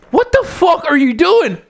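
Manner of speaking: mock a baffled outburst at her partner; exasperated rant. Dakota Kai yelling at her partner in the ring, high and exasperated. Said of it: "and she'd just like".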